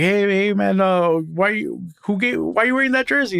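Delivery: Mexico fan calls to a French fan, lightly voiced; imitating Mexico fan. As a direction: call out to the stranger like a curious fan. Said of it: "He's like".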